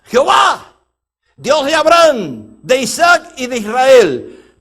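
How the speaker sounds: loud, high, slow invocation; calling on God. Acted. Prophet Elijah praying at the altar, loud invocation. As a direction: cry it out, stretching each name.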